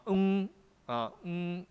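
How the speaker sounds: "嗯" hummed high and level; first tone. Shown as a demonstration. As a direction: hold "嗯" on one steady high tone. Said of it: "像注音的第一，第一音".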